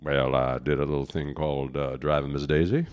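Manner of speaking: low, measured actor impression; deep, easygoing narrator-like voice; Morgan Freeman. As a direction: drop low for a film-star impression. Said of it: "You want Morgan Freeman?".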